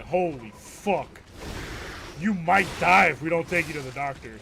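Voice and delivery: high, loud, drawn-out mock shock; hammed-up alarm. Aunt reacts to the fake burn, hammed-up alarm. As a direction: overplay the grave emergency.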